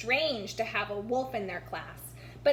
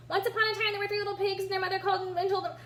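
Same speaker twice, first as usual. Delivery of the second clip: fast, breathless, one big word; rushed reading; 7 semitones higher, much faster than usual. A narrator's reading. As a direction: race through with no spaces. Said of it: "like it was all one big word with no spaces between".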